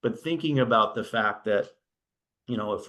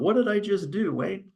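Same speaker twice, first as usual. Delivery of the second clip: much higher, a sudden startled yelp of a thought; 11 semitones higher than usual. Retold or imitated. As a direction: caught off guard, pitch jumps up. Screen changes suddenly, startled thought.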